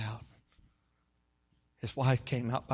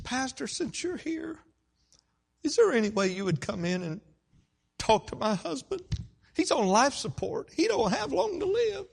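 high imitated tearful plea, growing louder; distraught pleading; 14 semitones higher, 6 dB louder than usual. Wife begging pastor to talk to her husband, distraught. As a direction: beg as a weeping wife would. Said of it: "she's just distraught".

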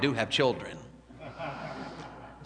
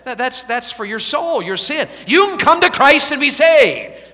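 high, loud, ringing revival preaching; full-force preaching; 9 semitones higher, 8 dB louder than usual. Acted. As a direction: drive the gospel call home to the crowd.

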